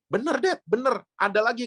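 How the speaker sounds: imitating excited daughter. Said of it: "daughter insists, voiced high".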